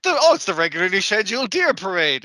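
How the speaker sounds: hammed-up delight at a big event; mock excitement. Podcaster mimicking the crowd at the deer parade, mock excitement.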